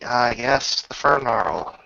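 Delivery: ordinary delivery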